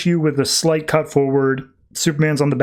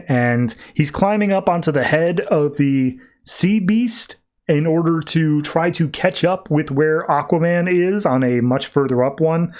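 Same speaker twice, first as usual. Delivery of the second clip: usual speaking voice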